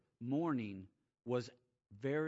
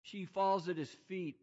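rising, louder preacher's build; impassioned preaching, higher and louder; preaching at full force; 11 semitones higher, 5 dB louder than usual. Acted. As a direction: climb in pitch and volume, preaching.

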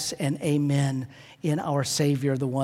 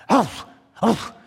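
short higher-pitched dog woofs; imitated dog woof; 6 semitones higher, 5 dB louder than usual. Pastor imitating his late dog talking to him, playful woofs. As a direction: woof twice like a little dog asking for something. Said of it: "He'd come up, and he'd just go".

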